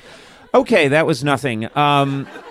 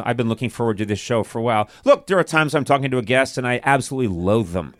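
ordinary talk

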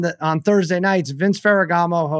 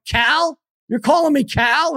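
much higher, incredulous retort; mock disbelief; 11 semitones higher, slower than usual. Retelling his reply to the host, high disbelief. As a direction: act stunned at the wrong name. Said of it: "I'm like".

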